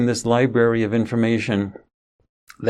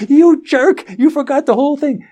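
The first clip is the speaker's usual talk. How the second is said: imitated angry shout; 7 dB louder than usual. Son shouting at father.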